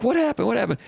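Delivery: mock-startled coaches wanting an explanation; puzzled questioning. Coaches asking the laughing players.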